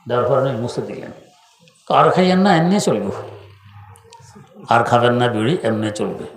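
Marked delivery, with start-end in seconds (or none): 1.9-3.0 higher, Noakhali dialect admonition. Retold or imitated. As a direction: gently forbid it in Noakhali dialect, pitched up. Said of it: "নোয়াখালীর ভাষায় কথা বলতেন". (নোয়াখালীর ভাষায় উঁচু গলায় নিষেধ)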